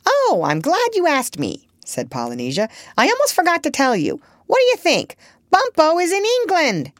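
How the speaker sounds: high, loud, fast parrot chatter; excited parrot. Acted. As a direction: burst out with the news, eager. Parrot eager news.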